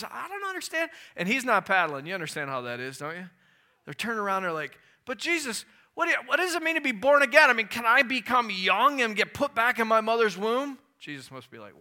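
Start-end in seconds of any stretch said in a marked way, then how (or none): 0.0-0.9 high confused voice; higher, bewildered Nicodemus asking; puzzled Nicodemus. Retold or imitated. 5.0-10.8 high, loud, fast bewildered questions; higher and louder, tumbling confused questions; baffled Nicodemus. Retold or imitated. Nicodemus questions Jesus, acted.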